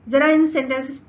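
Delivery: ordinary talk, nothing marked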